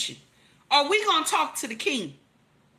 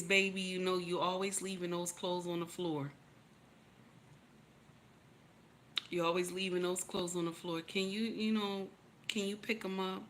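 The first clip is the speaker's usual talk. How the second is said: soft sweet voice; 5 semitones lower, 11 dB quieter than usual. Shown as a demonstration. Wife asking husband softly to pick up clothes, tender. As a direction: lower and much softer, gentle request. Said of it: "What you supposed to say is".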